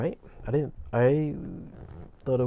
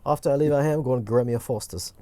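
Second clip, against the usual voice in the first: lively amateur Aussie twang; playful Foster's-advert Australian; mock Australian accent; 4 dB louder than usual. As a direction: ham up a bad Australian accent. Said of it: "if you feel that was a good Australian accent".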